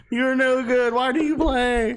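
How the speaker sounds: hammed-up mocking jeer; mock taunt. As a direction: jeer at them from on high. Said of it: "I'm like".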